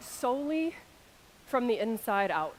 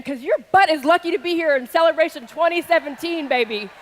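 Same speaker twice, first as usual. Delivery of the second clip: loud, raised, rousing delivery; hyping the crowd; 5 semitones higher, 9 dB louder than usual. Real, not acted. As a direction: fire up the audience.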